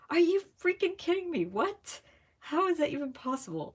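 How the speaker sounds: disbelieving. Retold or imitated. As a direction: hit it like total disbelief. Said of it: "I'm just like".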